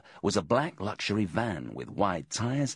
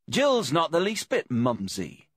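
high, louder, jaunty remark; cheerful; 10 semitones higher, 5 dB louder than usual. A narrator's reading. Detective jokes about his armed accomplice, cheerful. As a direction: say it cheerfully, voice lifted. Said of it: "Deeks said cheerfully".

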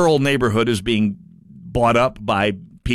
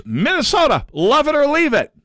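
sarcastic paraphrase; 12 semitones higher than usual. Acted. Host mocking a senator's slogan, higher and sarcastic. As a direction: sneer the slogan back at him.

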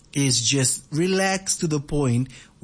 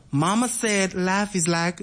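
slow, higher Alabama drawl; Alabama accent; 4 semitones higher, slower than usual. Acted. Radio host wants the line completed, slow Alabama accent. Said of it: "a very distinctive Alabama accent".

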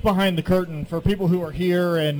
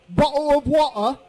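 higher-pitched imitation English accent, dropped T's; mock English accent with glottal stops; 7 semitones higher than usual. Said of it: "an English accent".

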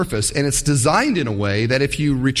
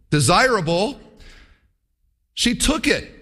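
ordinary talk, nothing marked

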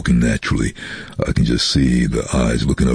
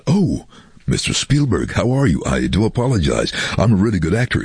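higher-pitched mock ingratiation; butter up the famous director, hammed up; fawning; 7 semitones higher than usual. Voice artist suddenly realising Spielberg is listening, fawning.

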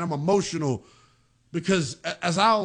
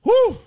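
high whew; 6 dB louder than usual. Real, not acted. Exhales after hearing coach clip.

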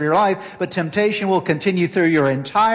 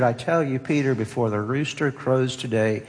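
solemn Jesus; 4 semitones lower, 5 dB quieter than usual. Retold or imitated. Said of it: "Jesus answered".

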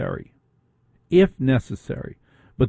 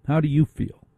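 usual speaking voice

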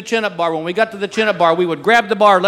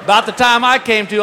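ordinary delivery

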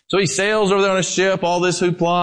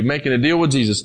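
ordinary talk

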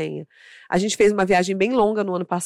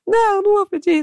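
high-pitched mock tantrum; birra chorosa imitada; 12 semitones higher, 4 dB louder than usual. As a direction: refuse like a child about to cry. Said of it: "começou a chorar". (birra aguda de criança, imitando)

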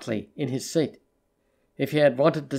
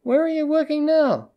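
much higher, insistent repeat; 11 semitones higher than usual. A narrator's reading. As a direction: pitch it right up and drop on NOW. Repeats the question after "What?.

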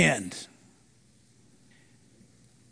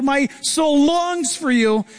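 high, slow, aching plea; a mild, yearning cry to God; longing cry; 7 semitones higher, slower than usual. Retold or imitated. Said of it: "The psalmist is crying out".

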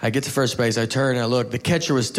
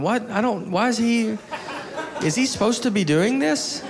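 baffled, higher voice; confused catcher; 8 semitones higher than usual. Retold or imitated. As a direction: sound totally lost. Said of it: "the catcher's holding the ball going".

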